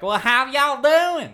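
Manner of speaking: loud, high, drawn-out Southern American twang; bad American accent. British person asked to do American, hammed-up Southern accent. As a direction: overdo a y'all Southern accent. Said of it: "do an American accent".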